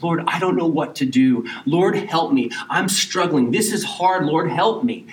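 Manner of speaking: urgent demonstrated cry for help; crying out to God. Shown as a demonstration. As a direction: plead for help as a preacher demonstrating prayer. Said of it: "Crying out is like".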